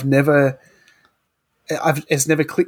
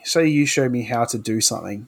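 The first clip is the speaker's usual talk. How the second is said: ordinary talk, nothing marked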